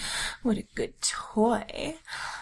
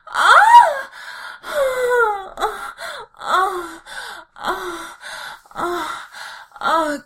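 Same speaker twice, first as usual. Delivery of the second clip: climax cries; 8 semitones higher, 6 dB louder, much slower than usual. Acted. Giantess climaxing.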